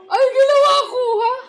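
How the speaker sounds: huge high crying shout; mock wail of outrage, very high and loud; outraged shout.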